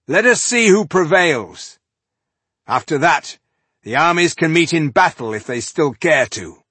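loud, high proud challenge; calling out. A narrator's reading. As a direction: call it out for both armies to hear. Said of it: "he called".

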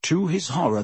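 ordinary delivery, nothing marked

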